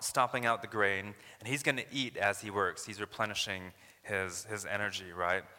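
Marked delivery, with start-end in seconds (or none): none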